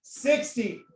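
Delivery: ordinary talk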